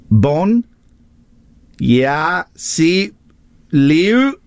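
sounding out words in reverse; slow, halting syllables said backwards; backwards speech. Shown as a demonstration. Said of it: "I'm gonna say something backwards".

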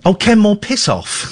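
lightly voiced club owner telling him off; mock angry boss. The club owner's email, mock curt dismissal.

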